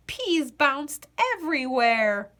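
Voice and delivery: ordinary delivery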